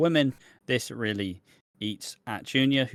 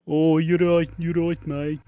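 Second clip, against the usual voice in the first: higher, louder put-on Brummie; Brummie accent; 6 semitones higher, 5 dB louder than usual. Alfie Moon after a Birmingham course, in a mock Brummie accent. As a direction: drag the vowels like a Brummie. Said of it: "imagine Alfie Moon just".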